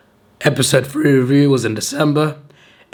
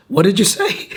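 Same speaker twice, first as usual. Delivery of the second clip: squeaky high confused asking; imitating a coworker who can't hear; high mock puzzled coworker; 9 semitones higher than usual. Coworker at work asking him to repeat, high puzzled voice. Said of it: "my coworker said".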